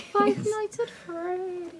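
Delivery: high, slow sing-song title; high drawn-out voice. Pretend. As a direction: stretch it out high and silly. Repeats title in funny voice.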